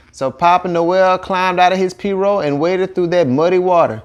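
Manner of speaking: ordinary delivery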